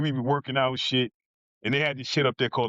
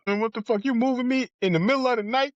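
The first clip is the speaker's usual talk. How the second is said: startled protest; 10 semitones higher than usual. Retold or imitated. Woken at 4am, told roll up.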